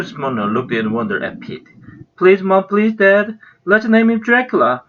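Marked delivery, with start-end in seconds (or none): 2.2-4.8 high, eager begging; pleading cry. Acted. Toby begging his parents to name the rabbit, high pleading cry. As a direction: beg like a kid who wants it badly. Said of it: "cried Toby".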